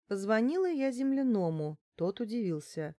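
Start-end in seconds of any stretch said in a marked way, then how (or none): none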